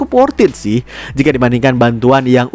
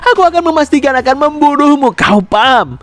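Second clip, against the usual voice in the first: ancaman; 5 semitones higher than usual. A narrator's reading. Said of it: "memberikan ancamannya". (mengancam dengan nada meninggi)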